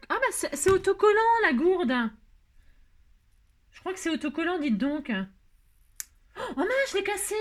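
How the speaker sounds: high, startled exclamation; dismayed surprise. Real, not acted. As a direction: blurt it out on discovering your blunder. Discovers mistake, breaks the piece.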